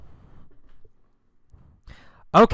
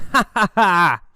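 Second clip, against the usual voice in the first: high, loud taunting laugh; mock laughing; 5 semitones higher, 5 dB louder than usual. Alan laughing as he zooms past Tom, gloating. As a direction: laugh triumphantly at the loser, ha by ha.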